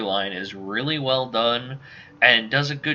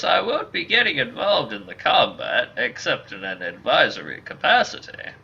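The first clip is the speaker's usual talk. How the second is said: put-on high, swooping old man voice with a chuckle; jovial elder, pitch leaping up; lively old mercenary; 6 semitones higher, 4 dB louder than usual. Old mercenary excusing himself from combat to Ender, high animated old man.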